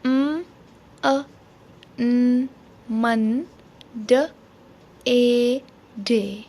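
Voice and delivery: म, अ, न, मन, ड, ए, डे as separate sounds, then blended; sounding out Monday. Shown as a demonstration. Teacher sounds out Monday for children learning phonics, sound by sound. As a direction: say म, अ, न, मन, ड, ए, डे one by one with pauses. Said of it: "इसको पढ़ा जाता है Monday".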